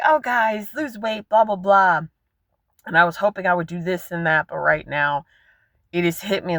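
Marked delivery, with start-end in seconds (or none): 0.0-2.0 higher, bouncy fake cheer brushed off with blah blah; sarcastic imitation of an upbeat coach; mock cheerful pep talk. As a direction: send up your own upbeat encouragement. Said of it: "try to be happy-go-lucky".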